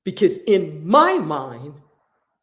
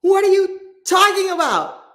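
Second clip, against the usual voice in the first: high, louder exasperated yell; 12 semitones higher, 8 dB louder than usual. Retold or imitated. Yelling at the inmate who said he wasn't ready, exasperated shout. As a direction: yell it at him in disbelief. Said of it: "now I'm yelling at him".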